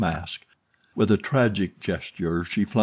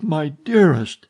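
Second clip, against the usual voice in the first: higher and louder aching cry; anguished moan; 7 semitones higher, 6 dB louder, slower than usual. A narrator's reading. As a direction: let the grief swell out. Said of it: "he moaned".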